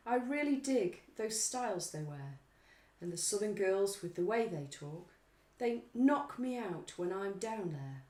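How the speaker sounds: slow, lilting poem recital; poetry reading. Pretend. Co-host reads pop lyrics as if reciting Keats. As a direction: recite it like a romantic poet. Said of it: "It's like poetry class at school".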